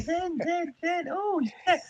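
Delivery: very high gushing dog voice; sugary high puppy talk; mock baby talk to a dog. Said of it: "Talk to your dog in a normal voice".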